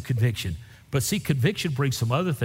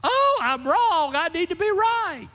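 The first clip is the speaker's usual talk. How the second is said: very high, slow mock repentance; mock contrite voice. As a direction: parody the humble confession nobody makes. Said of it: "their first response is not".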